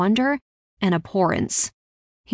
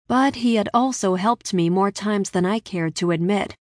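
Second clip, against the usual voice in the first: plain reading voice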